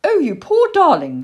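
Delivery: high, gushing sympathy; sweet young lady. A narrator's reading. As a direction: fuss kindly over a fallen little creature. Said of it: "said a sweet young voice".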